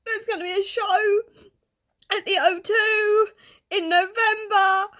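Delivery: crying with happiness as you speak; happy tears. Real, not acted. Fan telling viewers her band is back, tearful. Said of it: "Hence the tears".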